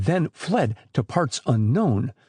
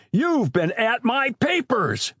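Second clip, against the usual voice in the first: high, slow accusing cry; the captain screaming at the boys over his papers; furious shriek; 11 semitones higher, slower than usual. A narrator's reading. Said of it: "he shrieked".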